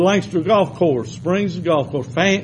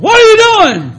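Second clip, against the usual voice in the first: a much higher, louder yell as the boss; angry boss; 10 dB louder, faster than usual. Retold or imitated. Preacher voicing his boss catching him ignoring the cussing, high loud yell. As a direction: bark it out like a startled, angry boss.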